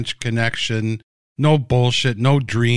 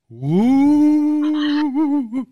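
high, eerie ghostly woo; mock the spooky set with a ghost sound. Mocking a dream sequence set, a high spooky ghost woo.

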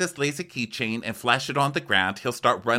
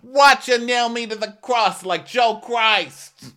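much higher, slow martyr whine; mock Joe; 13 semitones higher, 6 dB louder than usual. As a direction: play the martyr way up high. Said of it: "he's like".

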